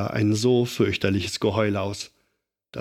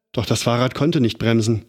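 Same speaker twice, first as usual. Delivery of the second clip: ordinary delivery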